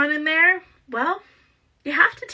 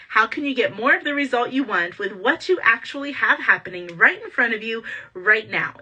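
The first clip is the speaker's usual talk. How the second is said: ordinary delivery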